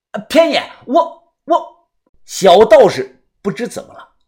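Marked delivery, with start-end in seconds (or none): 0.1-1.9 high, stammering unease; halting, reluctant young man; hesitant. A narrator's reading. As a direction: falter and repeat yourself in a high voice. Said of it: "他讲话有些吞吞吐吐".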